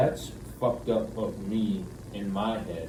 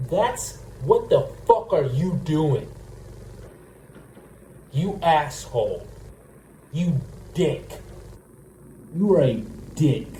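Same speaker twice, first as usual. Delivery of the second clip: furious yelling; 5 semitones higher, 5 dB louder than usual. Acted. Imagined rant at girlfriend.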